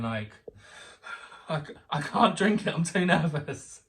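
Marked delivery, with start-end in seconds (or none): 1.5-3.8 put-on higher and louder nervous child's voice; a child too nervous to drink her hot chocolate; nervous excitement. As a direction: gush it like a jittery excited kid. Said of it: "just going".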